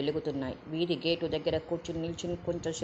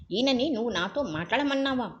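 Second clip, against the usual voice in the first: angry demand; 6 semitones higher, 4 dB louder than usual. Acted. Father interrogates son angrily.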